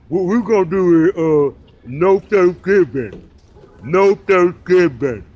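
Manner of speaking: loud, slow, closed-mouth radio voice; hammed-up slow loud mumble; mocking impression. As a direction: lampoon a host hiding his teeth. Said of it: "He go".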